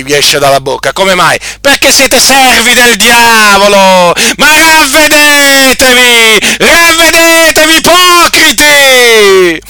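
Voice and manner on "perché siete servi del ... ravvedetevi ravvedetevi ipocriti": high, loud pulpit denunciation; fiery preaching. Acted. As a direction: thunder at them from the pulpit. Call to repent before God's wrath.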